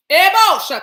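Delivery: sudden loud, high outburst; explosive interjection; spirit exclamation. Real, not acted. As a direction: shout the syllables as if jolted. Sudden spirit exclamation mid talk.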